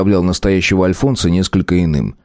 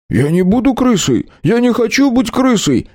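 indignant. A narrator's reading. The deputy protests being called a rat, indignant. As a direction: refuse it with wounded outrage. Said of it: "искренне возмутился депутат".

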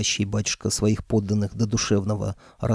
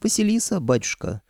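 coy high voice; 6 semitones higher than usual. Acted. Бесовка кокетливо называет себя.

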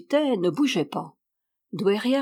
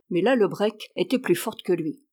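usual speaking voice

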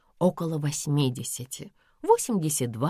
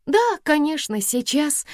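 flustered; 12 semitones higher, 4 dB louder than usual. A narrator's reading. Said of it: "засуетилась Лика".

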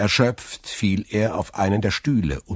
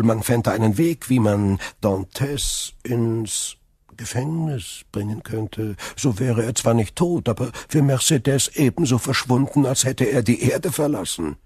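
higher, smooth persuasive villain; scheming Danglars; 6 semitones higher, 4 dB louder than usual. Acted. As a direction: tempt him gently toward the plot. Danglars plotting.

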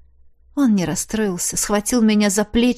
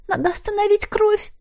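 much higher urgent cry; high panicked maid; panicked squeak; 12 semitones higher than usual. Acted. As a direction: squeal for help.

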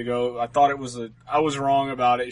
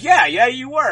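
high, emphatic mock agreement; pointed retort in a raised pitch; imitated wife's retort; 8 semitones higher, 5 dB louder than usual. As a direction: act out an annoyed wife agreeing, louder. Wife's retort acted in story.

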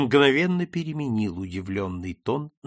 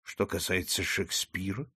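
softer, humble reply; meek; 5 semitones lower, 7 dB quieter than usual. A narrator's reading. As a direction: answer gently, not rising. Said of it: "кротко возразил мой друг". (кротко и тише)

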